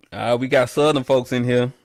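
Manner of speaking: ordinary talk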